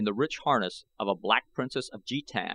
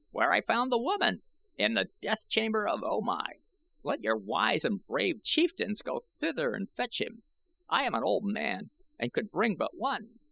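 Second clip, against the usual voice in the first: put-on high, reedy old man's voice, boastful; 9 semitones higher than usual. A narrator's reading. Old I Gos gloating to the young warriors, high and reedy. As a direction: crow about it like a proud old man.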